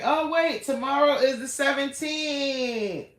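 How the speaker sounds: catching herself as she remembers the date; sudden realization. Real, not acted. Planning tomorrow's outfit, she suddenly remembers the date, high and surprised.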